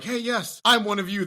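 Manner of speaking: high, overkeen announcement; mock eager greeting. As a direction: claim kinship with hammed-up eagerness. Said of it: "be like".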